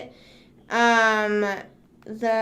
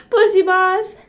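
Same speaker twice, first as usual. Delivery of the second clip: high, loud silly voice; exaggerated squeal; 8 semitones higher, 8 dB louder than usual. Streamer playing with own phrase, high silly squeal. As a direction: squeal "Bussy boss" up high.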